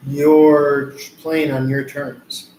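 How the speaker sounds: very slow, weighted words; slow and deliberate. Real, not acted. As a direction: drag it out to make the point. Slow emphatic point in reading.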